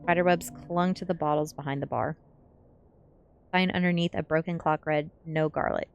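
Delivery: usual speaking voice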